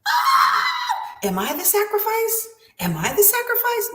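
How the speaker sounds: high, fretful mock panic; anxious worry. Imitating a fearful listener asking if they're the sacrifice, high and anxious. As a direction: squeak out the worry like a scared sheep. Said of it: "because you're too focused on".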